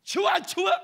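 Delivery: very loud, high-pitched yell; furious repeated command at full voice; forceful order. Retold or imitated. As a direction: shout it hard, as an angry demand. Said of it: "이렇게 세게 하려다가".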